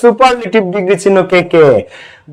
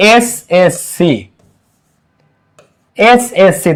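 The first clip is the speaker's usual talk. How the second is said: SSC slowed, each letter said separately; slow, spaced-out letters in SSC; much slower than usual. Shown as a demonstration. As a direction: spell out SSC slowly for the class. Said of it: "প্রথম অক্ষরটা উচ্চারণ হবে".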